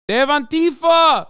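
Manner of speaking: high, loud cry of They have Antifa; mock protester shout. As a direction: shout it like a riled-up Brazilian rally crowd. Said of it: "They're saying".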